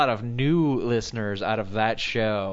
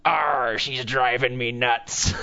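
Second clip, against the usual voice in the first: slow gruff pirate growl; much slower than usual. Retold or imitated. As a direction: ham up a pirate, slowly. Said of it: "And the pirate says".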